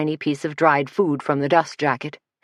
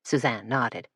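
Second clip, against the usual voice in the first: ordinary delivery, nothing marked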